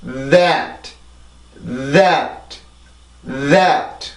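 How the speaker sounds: "That" with drawn-out voiced th; English "That" with vibrating onset; English th demo. Shown as a demonstration. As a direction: buzz first, then stress the æ in "That". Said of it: "자, 다시 발음해 볼게요".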